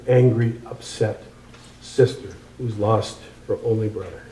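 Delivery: normal talking voice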